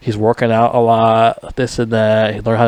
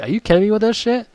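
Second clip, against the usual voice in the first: higher, fast, disbelieving; exasperated rant; 6 semitones higher, 4 dB quieter than usual. Retold or imitated. Vlogger scolds hero for ignoring wife, higher and exasperated. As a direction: throw up your hands at him, higher. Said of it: "I'm like".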